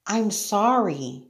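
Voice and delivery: gently acted timid sorry; apologetic Sarah. A narrator's reading. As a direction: say sorry in a small girl's voice.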